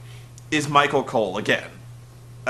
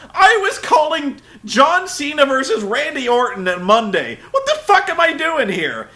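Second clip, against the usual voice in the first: high, raised voice with a laugh; mock indignant Cole; 10 semitones higher, 4 dB louder than usual. Cole complaining about his assignment, higher mock indignation. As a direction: sound insulted to be stuck there. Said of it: "He's like".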